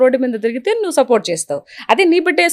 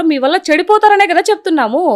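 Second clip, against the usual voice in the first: usual speaking voice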